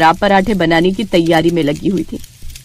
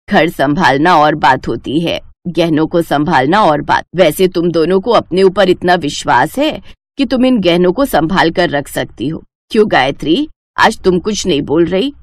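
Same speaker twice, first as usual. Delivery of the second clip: lower, measured mother-in-law voice; stern mother-in-law; 6 semitones lower than usual. Acted. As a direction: speak as the wise old mother-in-law, firm and knowing. Mother-in-law questions daughters-in-law. (धीमी, गंभीर सास की आवाज़)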